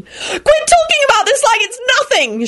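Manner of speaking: very high shrieking cry on the verge of tears. A narrator's reading. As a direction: shriek it out, near breaking. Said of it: "she shrieked and burst into tears".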